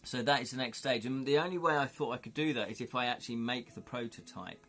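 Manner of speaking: ordinary delivery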